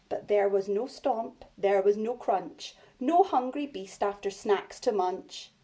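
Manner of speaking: put-on lively read-aloud for young children; story reading. A narrator's reading. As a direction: read it big and bouncy for little ones. Reads picture book to class.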